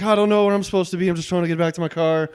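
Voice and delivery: high, fast put-on Irish accent. Guy bluffing a birthday group in an Irish accent. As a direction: throw them off with a quick Irish accent. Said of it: "Irish football player".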